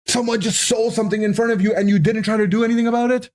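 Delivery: put-on high, fast, exasperated kid's rant; frustrated outburst. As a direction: blurt it out fast and high, betrayed. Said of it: "being so frustrated".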